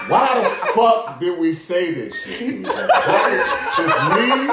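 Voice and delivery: laughing through the words; high, loud laughing disbelief. Real, not acted. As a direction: crack up as you say it. Laughing at their own dumb plan.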